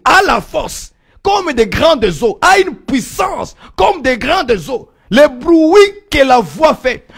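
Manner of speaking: loud preacher building phrase on repeated phrase; full-force preaching. Acted. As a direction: proclaim the power of the word.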